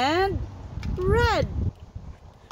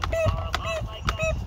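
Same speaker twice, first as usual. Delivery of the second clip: high squeaky peeps; chick peep imitation; 3 dB quieter than usual. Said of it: "What does the chick say?".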